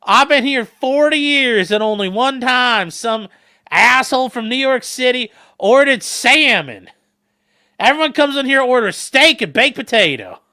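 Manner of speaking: loud, high, stretched-out waitress voice; old lady waitress. Retold or imitated. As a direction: lay down the menu like a bossy old waitress. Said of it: "She's like".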